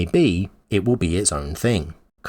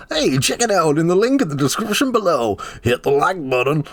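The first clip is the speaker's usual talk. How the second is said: much higher halting Walken voice on the outro; 8 semitones higher than usual. Acted. YouTuber reading his outro in Walken voice, higher. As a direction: slip back into the bad Walken. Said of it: "That's the worst accent I've ever heard".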